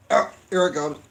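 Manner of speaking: ordinary delivery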